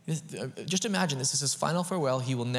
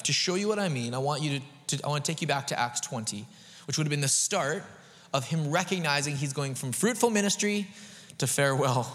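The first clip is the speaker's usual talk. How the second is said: ordinary talk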